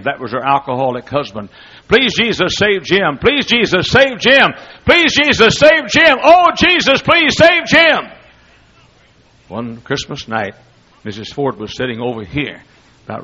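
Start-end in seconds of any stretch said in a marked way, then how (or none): 1.9-8.1 starts firm, climbs much higher and louder to a pleading wail; pleading prayer rising from steady to a desperate high cry; building prayer cry. Retold or imitated. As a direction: pray harder each time until you're crying out. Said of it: "I've heard her pray all night long".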